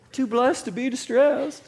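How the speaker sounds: sing-song, high, laughing parody of a pat reply; mock cheery church answer. Pastor mocking a pat answer to the congregation, high and laughing. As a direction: lilt it up high like a too-bright churchgoer.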